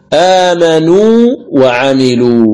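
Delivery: آمنوا وعملوا recited slowly, the ū of آمنوا held before a distinct waw; madd al-tamkin. Shown as a demonstration. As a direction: lengthen the end of آمنوا so the two waws stay apart. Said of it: "بين الواوين أو الياءين".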